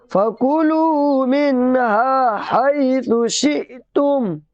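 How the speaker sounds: chanted, with शिअतुम cut by a catch after शि; jolted hamza. Shown as a demonstration. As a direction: give शिअतुम a sudden jolt on the hamza. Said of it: "यहाँ झटके के साथ बोलेंगे". (शिअतुम में हमज़ा पर झटका)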